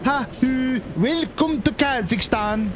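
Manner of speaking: slow, high, hammed-up Scandinavian-ish greeting; mock Scandinavian accent. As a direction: play a cheesy Scandinavian border guard welcoming you. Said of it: "in an accent that is meant to be Scandinavian".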